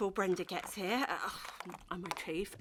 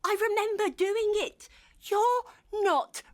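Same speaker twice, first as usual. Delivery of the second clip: insistent; 8 semitones higher, 6 dB louder than usual. Acted. Insisting loudly.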